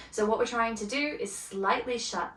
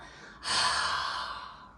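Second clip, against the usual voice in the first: long breathy exhale through an open mouth, fogging a mirror. Shown as a demonstration. Teacher showing students the mouth exhale, long breathy huff. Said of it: "Yeah, I exhale".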